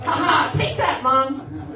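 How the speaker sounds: comic crowing to the crowd; triumphant gloat. Real, not acted. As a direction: gloat at mom in victory. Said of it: "triumphant punchline".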